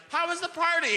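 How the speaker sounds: high sing-song parent asking; bright mock-parent question; chirpy mum at the door; cheery parent voice. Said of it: "you're like".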